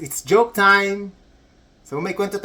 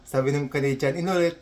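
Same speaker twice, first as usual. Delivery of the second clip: ordinary talk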